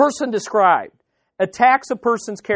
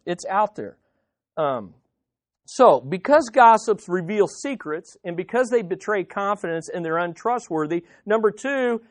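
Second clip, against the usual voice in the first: ordinary talk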